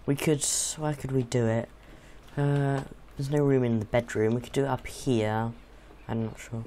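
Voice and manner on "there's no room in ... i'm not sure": ordinary talk, nothing marked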